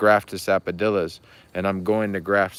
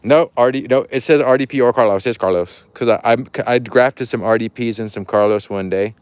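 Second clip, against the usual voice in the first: loud; 3 semitones higher, 8 dB louder than usual. Real, not acted. Loud excited correction.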